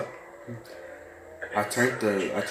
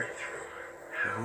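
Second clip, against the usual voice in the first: whispering; 10 dB quieter than usual. Real, not acted. Searching for the map.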